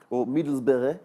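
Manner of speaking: accidental slip into a Dutch accent. Real, not acted. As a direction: say the place name with a Dutch accent. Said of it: "said that in a Dutch accent".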